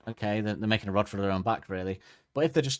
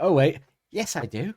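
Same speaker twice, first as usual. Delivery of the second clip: higher, louder "Oh, wait, yes" with a sneer; mock the bully's fake apology; mocking backtrack; 6 semitones higher, 5 dB louder than usual. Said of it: "and they go".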